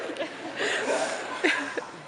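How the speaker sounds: breathy laugh through tears; embarrassed laugh. Real, not acted. Tearful speaker laughs it off.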